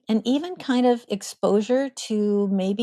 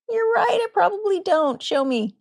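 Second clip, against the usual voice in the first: playful, much higher voice with a light teasing touch; gentle humor; 11 semitones higher than usual. Acted. Parent answering a snarling child with gentle humor. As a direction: tease gently, head tilted, easy and light. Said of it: "say with gentle humor".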